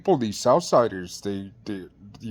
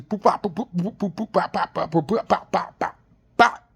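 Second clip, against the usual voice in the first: higher, clipped boop bop punch sounds; imitated punches; 6 semitones higher than usual. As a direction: tap out each blow with a quick boop and bop. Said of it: "just like how boxers says".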